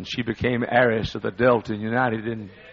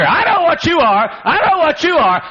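high, loud shriek of accusation; retold scream; 9 dB louder than usual. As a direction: scream it at him across the plane. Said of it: "everybody on the plane could hear".

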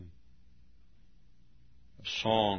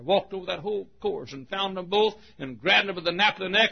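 ordinary talk

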